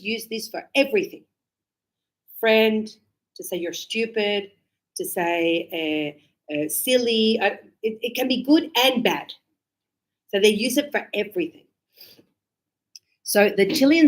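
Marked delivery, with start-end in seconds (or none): none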